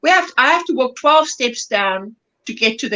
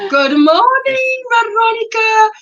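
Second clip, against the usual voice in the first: high, loud, drawn-out welcome; calling out a greeting; 11 semitones higher, 4 dB louder than usual. Real, not acted. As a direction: sing out a slow, high-pitched hello to a newcomer. Calls out greeting to newcomer.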